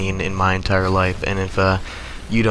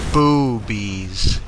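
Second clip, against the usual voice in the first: close-up punchline. Retold or imitated. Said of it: "got right up in my ear and he said".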